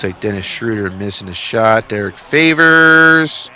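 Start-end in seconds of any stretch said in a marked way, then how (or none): 1.9-3.5 announcer call. Acted. Sports-sim commentary call.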